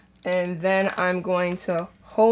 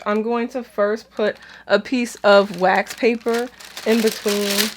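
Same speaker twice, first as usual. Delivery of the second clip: usual speaking voice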